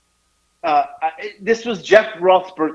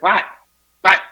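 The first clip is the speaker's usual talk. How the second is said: quick, higher-pitched baffled voice; imitating Jeff; 5 semitones higher, faster than usual. Wrestler mimics his pranked friend asking about the laughter, baffled imitation. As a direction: mimic a clueless friend, rapid and puzzled. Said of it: "that's how he talks".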